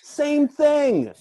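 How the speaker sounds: loud, high-pitched outburst; insistent shout. Real, not acted. Contestant disputing the ruling, insistent high shout.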